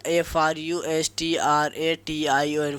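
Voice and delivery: slow dictation of ए एन जी ई आर; spelled out letter by letter. Shown as a demonstration. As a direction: spell ए एन जी ई आर slowly, each letter apart. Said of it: "frustration". (धीरे-धीरे अक्षर-अक्षर बोलना)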